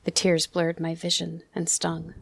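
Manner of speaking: plain reading voice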